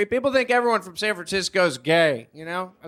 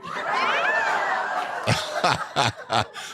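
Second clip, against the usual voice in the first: high laugh. Acted. Closing laugh.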